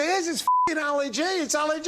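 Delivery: high-pitched, insistent local yokel; excited pub bloke. Retold or imitated. Pub bloke insisting he has spotted Ali G, high excited imitation. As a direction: imitate an overexcited fan, pitch way up.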